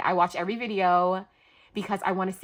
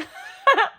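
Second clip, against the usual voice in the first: short, high, loud laugh; burst of laughter; 8 dB louder than usual. Real, not acted. Laughing at what she'd pay.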